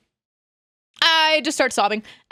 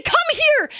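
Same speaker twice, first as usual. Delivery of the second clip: high, loud summons; shouted command to a helper; yelled order; 13 semitones higher, 4 dB louder than usual. Shown as a demonstration. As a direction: call the helper over loudly. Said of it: "You yell it as loud as you can".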